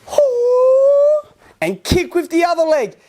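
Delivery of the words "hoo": long high hoo, slowly drawn out; stretched high karate cry. Shown as a demonstration. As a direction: let the hoo ring out high and slow. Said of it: "Let's go into the crane stance".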